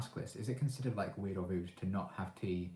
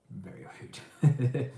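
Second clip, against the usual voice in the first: very rude drawn out slowly, louder and higher; mock scandalised punchline, slow and loud; mock emphatic; 5 semitones higher, 9 dB louder, much slower than usual. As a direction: stretch out very rude for comic effect. Joking punchline about rude Brits.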